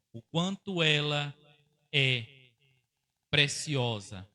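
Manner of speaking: a pastor's emphatic echo; emphatic repeat. Real, not acted. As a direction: drive the repeated phrase home. Repeats phrase, second stronger.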